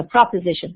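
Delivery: PROPOSITION with an O in the first syllable. Shown as a demonstration. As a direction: say "proposition", first syllable "prop", not "prep". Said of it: "with an O".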